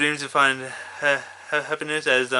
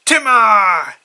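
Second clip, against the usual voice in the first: much higher, louder blurted name; Timmy's shout; 7 semitones higher, 10 dB louder than usual. Retold or imitated. Kid naming Timmy while blurting it in his voice. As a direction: belt it out in Timmy's strained cry.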